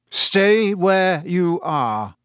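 slow, hoarse warning; wary teacher warning the pupils in hoarse tones. Acted. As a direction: hold them still, very slowly. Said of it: "in the same hoarse voice".

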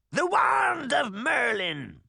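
high, slow witch cry; shrieking witch. Acted. Witch naming her stolen wand, shrieking. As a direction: draw it out in a high screech.

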